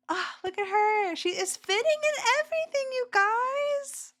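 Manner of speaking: high, delighted squeal over her progress; excited gushing. Real, not acted. Reacting to a fan's weight-loss clip, high excited squeal. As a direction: squeal it, thrilled for a stranger.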